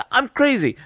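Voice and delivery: high mock English accent; put-on English accent. Imitating the coworker looking at him, high mock English accent. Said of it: "I'll use an English accent".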